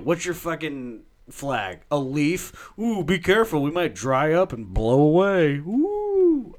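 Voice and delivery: high, loud sing-song mockery; taunting. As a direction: taunt them with a rising coo. Mocking Canada.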